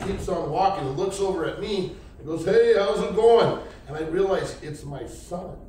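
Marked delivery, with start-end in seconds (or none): 2.4-3.6 loud, drawn-out casual greeting, pitched up; calling out. Retold or imitated. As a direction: holler it across the street. Son calls out to passing car.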